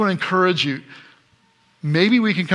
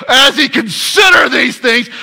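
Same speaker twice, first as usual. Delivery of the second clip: loud strained cry of a torn man; exasperated anguished shout; 7 semitones higher, 8 dB louder than usual. Shown as a demonstration. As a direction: cry it out like Joseph in agony. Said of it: "that's really what it was".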